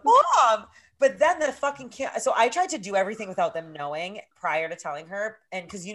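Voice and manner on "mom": high, loud retold protest; exasperated yell. Daughter protesting to mom, exasperated yell.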